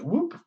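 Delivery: high rising whoop sound effect; swooping "whoop". Retold or imitated. Telling how they switch the fake Brook in, whoop effect. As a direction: imitate a quick swap with an upward whoop. Imitates the swap movement.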